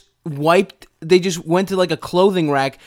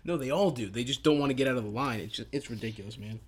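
hushed; 8 semitones lower, 14 dB quieter, faster than usual. Real, not acted. Keeping voice down.

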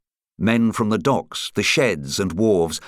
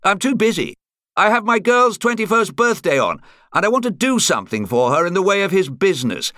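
high-pitched, insistent phone caller; 12 semitones higher, 4 dB louder than usual. A narrator's reading. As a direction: press your case down the phone. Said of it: "The voice at the end of the line was insistent".

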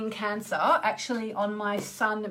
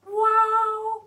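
high, loud delighted wow; surprised wow; 13 semitones higher, 6 dB louder than usual. Real, not acted. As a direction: gasp it out, amazed. Pleased surprise at own cards.

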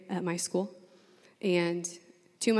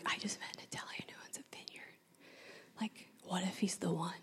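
an excited secret whisper; 18 dB quieter than usual. Retold or imitated. Sitting in class, whispering about the Italian. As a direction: whisper it so the class can't hear.

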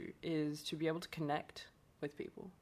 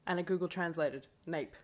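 normal talking voice

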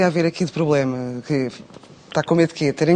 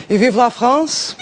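slow, hammed-up French cheer; French chef; 5 semitones higher, much slower than usual. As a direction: toast France like a proud chef. Host cheers in mock French. (francês exagerado e lento)

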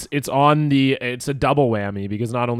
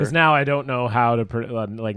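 ordinary talk, nothing marked

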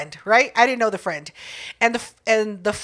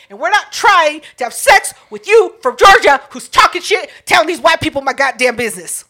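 worked-up retelling, higher and louder; upset retort; 4 semitones higher, 6 dB louder than usual. Retold or imitated. Concertgoer rejecting the man from Georgia, upset retort. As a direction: reenact an indignant rant at a stranger. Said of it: "I was so upset".

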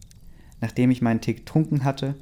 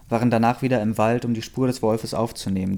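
ordinary delivery

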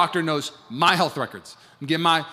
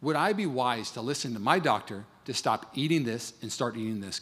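ordinary talk

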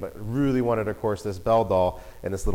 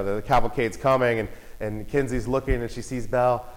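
ordinary delivery

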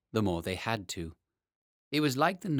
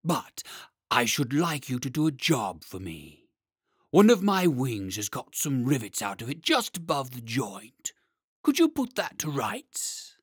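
louder dragon asking a favour; 3 semitones higher, 5 dB louder than usual. Acted. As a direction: ask the smith like a great creature. Dragon asks for repair.

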